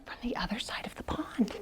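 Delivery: soft whispered narration; teacher whispering story narration. Acted. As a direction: whisper the tag line.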